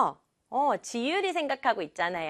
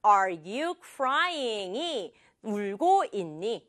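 normal talking voice

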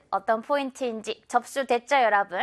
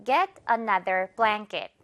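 once more slowly; slow drill; 2 semitones lower, much slower than usual. Shown as a demonstration. Teacher repeats model, slow. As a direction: Get another blanket said very slowly. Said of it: "One more time".

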